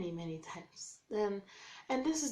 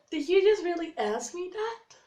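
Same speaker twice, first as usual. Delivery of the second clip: high, loud disbelief; stunned high yelp of disbelief; incredulous shock; 9 semitones higher, 10 dB louder than usual. Retold or imitated. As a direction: hit it like you can't believe your ears. Shocked reply to blunt proposal.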